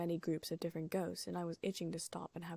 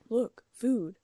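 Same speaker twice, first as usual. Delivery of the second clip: loud, higher look food; eager call; 5 semitones higher, 11 dB louder than usual. A narrator's reading. Ron pointing out food to his friends, loud and eager.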